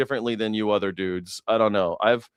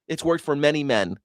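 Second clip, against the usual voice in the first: ordinary delivery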